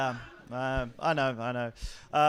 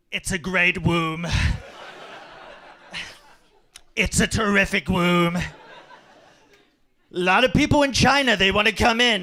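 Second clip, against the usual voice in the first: Trump impression; 3 semitones higher, 4 dB louder than usual. Trump as the unborn baby in the womb, boasting. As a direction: sell the womb like Trump selling a deal. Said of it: "my Trump impression".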